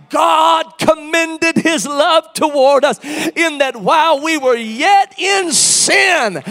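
loud, high, slow preaching cry; full-force preaching. Real, not acted. Preacher to congregation, slow ringing preaching cry.

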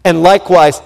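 ordinary delivery

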